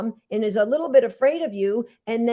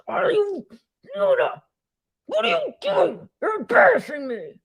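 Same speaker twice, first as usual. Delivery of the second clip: shrill, exasperated scolding of a dog, pitched much higher; an owner mortified in public, snapping at her dog; embarrassed scolding; 6 semitones higher than usual. Retold or imitated. Owner scolding Yoda on the sidewalk, high and exasperated.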